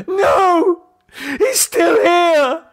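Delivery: high, crying, panicked rush; hysterical. A narrator's reading. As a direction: babble in sheer terror. David screams, crying.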